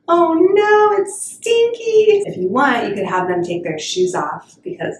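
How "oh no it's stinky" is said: very high, sing-song mock horror; loud squeal, stretching out the last word; hammed-up dismay. Reacting to a stinky feet card, playful mock horror.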